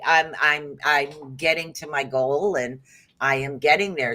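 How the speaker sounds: ordinary talk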